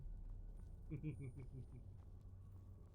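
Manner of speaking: unvoiced chuckle; breathy laugh. Real, not acted. Streamer cracking up mid-bit, breathy laugh. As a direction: laugh silently at yourself.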